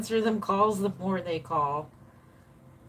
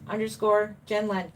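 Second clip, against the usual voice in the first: ordinary delivery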